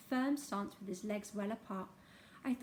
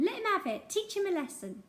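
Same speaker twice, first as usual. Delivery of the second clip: higher and louder, spiteful screech; shrieking; 7 semitones higher, 4 dB louder than usual. Acted. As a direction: urge the caning on with glee. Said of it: "she was shrieking".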